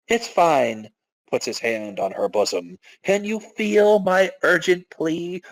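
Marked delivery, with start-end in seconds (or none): 3.0-5.4 high, slow breathy-free coo; cutesy plea. Acted. Kimiyawa pleading with the guard, slow and high. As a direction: lean in and beg.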